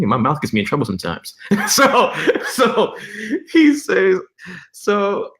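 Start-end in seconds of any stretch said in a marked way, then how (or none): 1.4-5.3 high-pitched, laughing through the words. Real, not acted. Storyteller cracking up at his own trouble-making mouth, laughing. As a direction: try to go on through real laughter.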